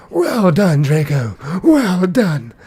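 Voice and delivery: high, loud theatrical villain praise; Lord Voldemort. Retold or imitated. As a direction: imitate a dark lord crying out in mock approval. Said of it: "he's acting like Lord Voldemort".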